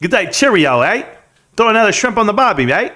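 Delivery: hammed-up Aussie with lilting "eh" endings; mock Australian accent. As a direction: put on a jokey Australian accent. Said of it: "I do Australian accents".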